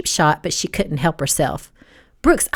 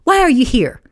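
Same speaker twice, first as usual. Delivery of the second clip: higher, louder accusing demand; pointed, hostile question; sneering; 6 semitones higher, 5 dB louder than usual. A narrator's reading. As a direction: confront with a sneer. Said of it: "she sneered".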